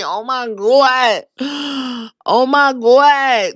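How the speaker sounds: slow, gasping oh my God; stunned gasp. Retold or imitated. Vlogger retelling her shock at Naomi's speech, slow gasping. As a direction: gasp it like the moment hit you.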